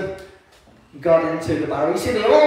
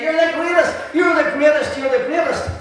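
crowd cheering; 8 semitones higher than usual. Retold or imitated. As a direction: chant it like fans cheering a hero. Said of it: "they were shouting and cheering".

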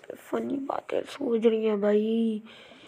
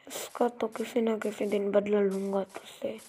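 ordinary talk, nothing marked

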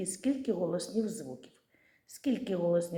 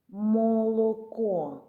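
"молоко" stretched slowly and a bit louder; slow sounding out; 3 semitones higher, 5 dB louder, much slower than usual. Shown as a demonstration. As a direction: draw out "молоко" to listen for the sound. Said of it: "А звук «у»". (повільно й виразно протягнути)

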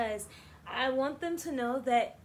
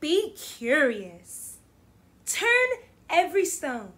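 expressive picture-book read-aloud; reading to children; 11 dB louder than usual. Acted. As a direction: read it warmly to a class. Teacher reads picture book aloud.

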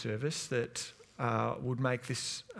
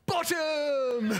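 put-on much higher, loud child's yell; cheeky shout; 14 semitones higher, 4 dB louder than usual. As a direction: shout Bottom! like a naughty little boy. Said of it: "just goes".

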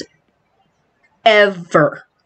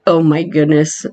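ordinary talk, nothing marked

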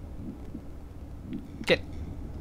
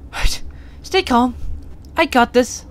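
nervous self-talk; 7 semitones higher, slower than usual. Real, not acted. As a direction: psych yourself up, tense. Reassuring himself while scared.

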